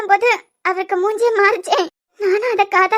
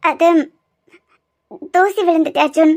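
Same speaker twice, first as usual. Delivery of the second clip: teary excuse; 4 semitones lower than usual. Real, not acted. Wife making an excuse to husband while still tearful. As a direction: cover the crying with a weak excuse. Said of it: "எதுக்கு அழுற?".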